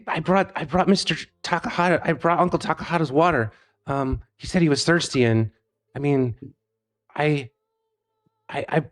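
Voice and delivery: put-on nervous kid tripping over his words to the elders; nervous stammering kid. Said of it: "and he's like".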